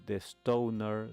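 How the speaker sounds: ordinary delivery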